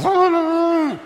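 high, sustained siren wail; imitated police siren. Storyteller imitates the police siren behind him, high wail. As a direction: mimic a cop's siren going off.

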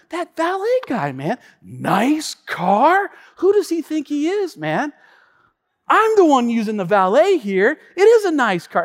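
high-pitched offended rant; hammed-up wounded pride; acted indignation. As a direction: complain as if personally insulted. Indignant complaint to his wife.

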